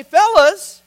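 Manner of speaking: retelling the speaker's fiery challenge to pastors; rousing exhortation. Retold or imitated. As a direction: build it into a call to the elders. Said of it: "Then he went on to say".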